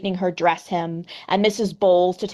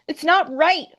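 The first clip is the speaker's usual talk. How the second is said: high, louder wailing protest; 10 semitones higher, 4 dB louder than usual. Acted. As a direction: wail it out like an upset guest. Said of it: "wailed Missus Bowles".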